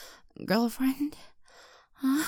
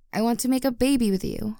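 eager; 3 semitones higher, 5 dB louder, faster than usual. Acted. Eager confession.

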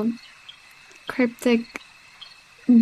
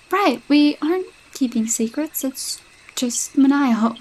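ordinary talk, nothing marked

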